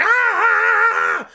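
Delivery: low, loud hammed-up yell; mock yell. Retelling everyone screaming, low loud mock bellow. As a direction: bellow it like a crowd in chaos. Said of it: "they're like".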